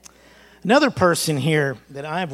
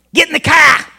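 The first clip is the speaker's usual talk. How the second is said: much higher, very loud Massachusetts mom yelling; furious shout; 13 semitones higher, 15 dB louder than usual. Retold or imitated. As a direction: yell at the kid to get in the car, Massachusetts accent. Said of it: "when she started talking funny".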